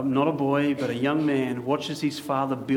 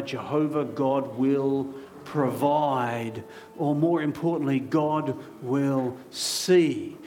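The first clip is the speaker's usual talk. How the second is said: slow emphasis; much slower than usual. Acted. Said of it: "preacher slows on key truth".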